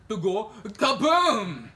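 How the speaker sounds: slow, loud, drawn-out explosion word; dramatic kaboom. Acted. Host mocking exploding Samsung phones, slow loud kaboom. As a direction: blow up the word kaboom for comedy.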